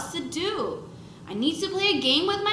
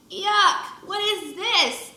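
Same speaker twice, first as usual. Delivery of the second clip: higher, slowed yuck; disgusted; 6 semitones higher, slower than usual. A narrator's reading. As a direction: recoil from the peel. Disgust at banana peel.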